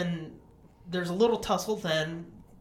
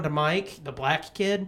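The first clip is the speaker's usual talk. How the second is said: normal talking voice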